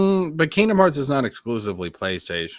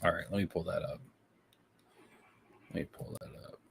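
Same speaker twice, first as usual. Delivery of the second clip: barely audible whisper; muttering to self; 4 semitones lower, 17 dB quieter, much faster than usual. Real, not acted. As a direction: whisper it absently to yourself while searching. Mutters to self while searching.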